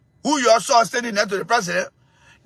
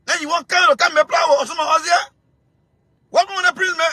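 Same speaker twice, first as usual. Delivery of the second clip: ordinary talk, nothing marked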